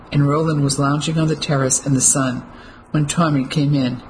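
ordinary delivery, nothing marked

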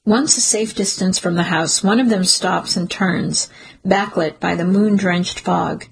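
plain reading voice

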